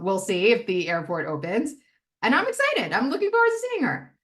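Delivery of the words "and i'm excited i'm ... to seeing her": high sing-out, pitched way up; sweetly high-pitched glee; happy excitement. Acted. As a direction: show innocent excitement in a very high voice. Demonstrating harmless excitement.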